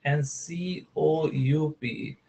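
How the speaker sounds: slow spelling out. Shown as a demonstration. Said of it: "word दिया था सीओयूपी".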